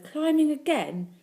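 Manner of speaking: high, loud, slow exclamation of disbelief; mother's reproach. A narrator's reading. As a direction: draw it out as a scandalised mother. Mother scolds child on chair.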